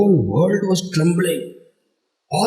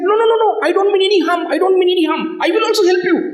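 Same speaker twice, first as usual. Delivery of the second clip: frantic pleading; 12 semitones higher, much faster than usual. Acted. Narada pleads with angry birds.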